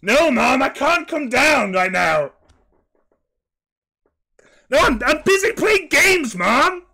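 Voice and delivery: high, loud yelling to mom; loud, high-pitched protest from a gamer kid; whiny shout at mom. Acted. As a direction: yell up at mom, annoyed at being interrupted. Kid yelling at mom mid-game.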